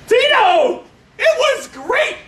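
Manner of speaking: high, stretched-out cheer. Real, not acted. Teacher cheering Tito after his turn, high excited shout. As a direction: whoop the praise up high.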